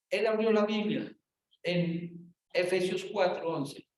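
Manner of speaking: ordinary talk, nothing marked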